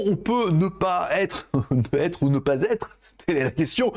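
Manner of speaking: slow, theatrical mock Shakespeare, laughing at the slip; hammed-up Hamlet. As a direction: ham up the famous soliloquy. Parodies Hamlet's to be or not.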